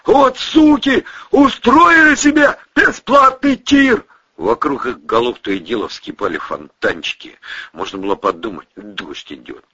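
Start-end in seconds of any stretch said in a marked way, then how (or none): 0.0-4.0 high, loud enraged yell; shouting. Acted. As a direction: curse at the shooters. Shouting under fire.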